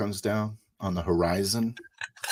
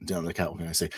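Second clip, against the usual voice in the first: ordinary talk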